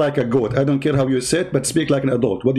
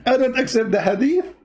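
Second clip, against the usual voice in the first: slightly higher, slow mock objector; imagined objector; 4 semitones higher than usual. Host imitating a guy who rejects hadith, lightly mock objection. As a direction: lightly put on an opponent, drawing it out. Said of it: "This guy will say to you".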